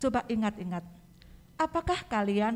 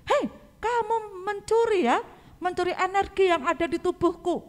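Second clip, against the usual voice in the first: pretend angry rebuke; 10 semitones higher than usual. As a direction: play-act a cross accusation. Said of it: "mungkin Tuhan Yesus akan marah". (pura-pura marah, nada tinggi dan lambat)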